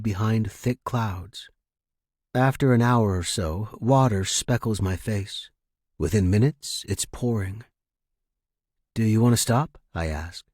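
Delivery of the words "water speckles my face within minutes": usual speaking voice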